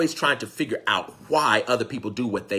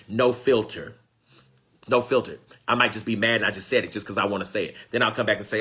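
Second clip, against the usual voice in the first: ordinary delivery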